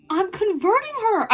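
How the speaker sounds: high, excited squeal; high-pitched triumphant announcement; gleeful exclamation. Acted. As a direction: burst out with it, high and delighted. Gleeful confession, repeated aloud.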